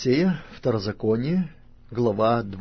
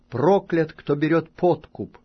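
raised, high solemn pronouncement; loud proclamation; 6 semitones higher, 6 dB louder than usual. A narrator's reading. Levites cursing whoever takes a bribe, loud proclamation. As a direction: proclaim the curse with force. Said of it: "скажут всем израильтянам громким голосом".

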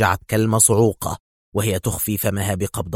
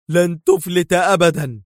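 higher, louder yell, peaking on أبدًا; full yell of a threat; furious yell; 7 semitones higher, 4 dB louder than usual. A narrator's reading. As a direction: scream the threat at the escaping car. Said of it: "ودار يصرخ".

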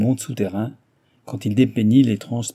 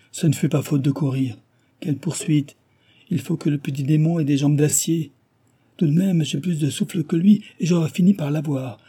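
higher, breathless servant telling the chase; lightly voiced excited servant; 6 semitones higher than usual. A narrator's reading. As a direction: rattle off the chase story eagerly. Servant Guilledou retells the chase.

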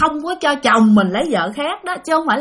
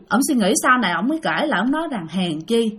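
ordinary talk

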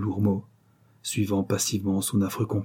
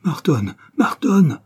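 rising cry, much higher and louder; desperate shout, pitch climbing; anguished cry; 7 semitones higher, 7 dB louder, slower than usual. A narrator's reading. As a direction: cry out a name in horror. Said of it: "se jeta sur lui avec un grand cri".

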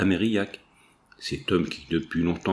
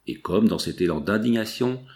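ordinary delivery, nothing marked

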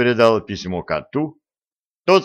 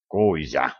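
laconic answer; 6 semitones lower than usual. A narrator's reading. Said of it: "лаконично ответил пёс".

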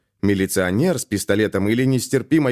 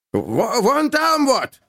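excited; 14 semitones higher, much slower than usual. A narrator's reading. Guard pointing at the trolleybus.

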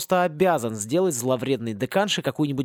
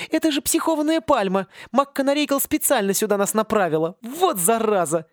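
put-on higher, excited exclaiming girl; alarmed girl; 8 semitones higher than usual. Mergiona realizing the teacher sent them to the palm, alarmed. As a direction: blurt it out in alarm.

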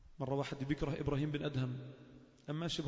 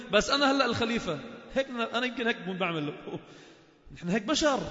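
loud, high impassioned sermon delivery; full-force Friday preacher; fiery preaching; 9 semitones higher, 9 dB louder than usual. Acted. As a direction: preach it out to the whole mosque.

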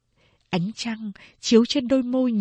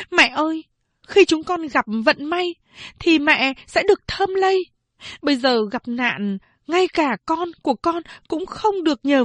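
high, raised demanding voice; insistent plea; 8 semitones higher than usual. A narrator's reading. Phán Đệ pleading with mother for fairness, raised voice. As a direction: push mother to be fair.

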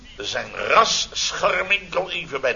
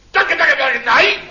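high, loud yelled dog command; Koeltjes yelling at his dog; 11 semitones higher, 8 dB louder than usual. Retold or imitated. Imitating Koeltjes yelling at his dog, high and loud. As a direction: bark it out like a frantic owner calling off his dog. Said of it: "dan roept meneer Koeltjes iets van".

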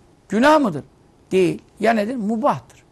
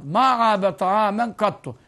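slow, solemn Arabic recitation; recited hadith; 3 semitones higher, much slower than usual. Real, not acted. Preacher quoting the Prophet's saying, slow recitation. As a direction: recite it slowly and reverently.

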